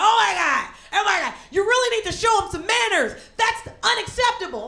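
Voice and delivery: high-pitched imitation of an outraged man; irate. Irate stranger scolds cousin of the boy, mock outrage. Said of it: "the guy got so irate".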